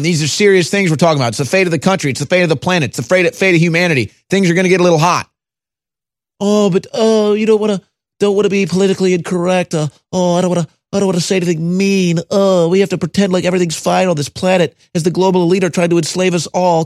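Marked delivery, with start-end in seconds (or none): 6.4-16.8 sarcastic pearl-clutching voice; mocking whiny voice. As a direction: lampoon the timid, fast and fussy. Mocking the politically correct.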